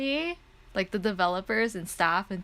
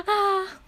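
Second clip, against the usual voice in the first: high gasp and happy sigh; gasp, then let out a high, moved "ah"; overwhelmed gasp; 12 semitones higher, 5 dB louder than usual. Real, not acted. Fan touched by the developers' welcome, high happy gasp. Gushing over heartwarming welcome.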